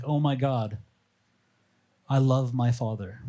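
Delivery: ordinary talk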